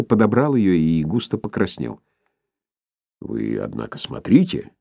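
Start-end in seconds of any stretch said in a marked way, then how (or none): 3.2-4.7 stern warning. A narrator's reading. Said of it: "предостерегающе и хмуро сказал".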